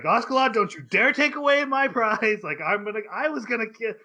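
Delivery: a big indignant protest; hammed-up protest. Thorkel protesting loudly.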